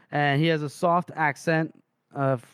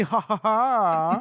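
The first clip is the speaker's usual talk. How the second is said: slow, high put-on "ha ha ha"; drawn-out acted guffaw; mock laugh; 6 semitones higher, 3 dB louder, much slower than usual. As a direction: laugh at your own joke, hammed up. Acts out character laughing at own joke.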